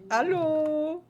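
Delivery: a cheery German "Hallo". Pretend. Streamer closes the German bit with a greeting, in a German accent. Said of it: "This is how much German I speak".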